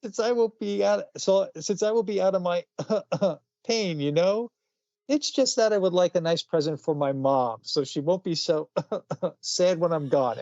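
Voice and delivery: put-on high, pleading child voice breaking into laughs; hammed-up dying child, cracking up; pitiful blind kid. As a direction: sickly kid asks Santa for a gift for mom. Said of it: "Little blind kid ... says".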